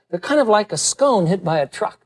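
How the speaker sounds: ordinary talk, nothing marked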